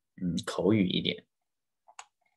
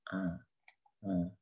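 ordinary talk

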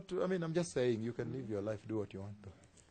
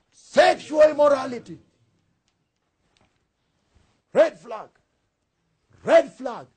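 raised, shouted sermon delivery; full-force preaching; 11 semitones higher, 15 dB louder than usual. Real, not acted. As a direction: thunder the scripture out to the congregation.

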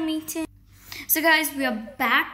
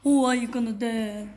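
dark scary voice. Pretend. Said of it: "talk in a dark, like, a dark, scary voice".

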